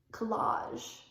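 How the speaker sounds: "collage" with stress on the second syllable; "collage", ending in a soft zh; college as collage. Acted. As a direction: say "collage" as kuh-LAHZH. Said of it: "I wrote collage".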